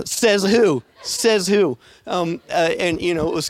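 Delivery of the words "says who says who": imitating a cocky taunt; mock challenge. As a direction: throw the challenge back, playful.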